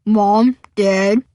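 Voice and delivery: dopey imitation of a big brother; mock a dim older brother; deep, dumb voice. A narrator's reading. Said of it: "he says in a deep, dumb voice".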